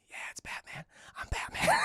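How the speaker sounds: whispered, voiceless hero boast; hushed Batman voice. Retold or imitated. Terry tells the kids he's Batman, whispering. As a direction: play it as a husky stage whisper.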